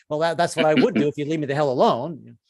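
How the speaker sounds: ordinary talk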